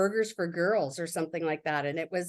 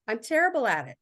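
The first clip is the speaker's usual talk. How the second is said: usual speaking voice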